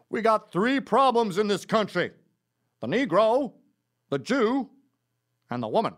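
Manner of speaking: loud southern rabble-rouser, high at first; put-on southern orator, booming; southern accent. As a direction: proclaim it in a southern accent, pausing between items. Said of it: "in a slightly southern accent".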